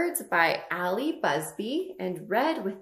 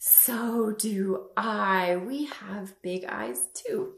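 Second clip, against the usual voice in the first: put-on reading to a toddler; 4 semitones higher than usual. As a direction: read it to little kids. Storytime book read to kids.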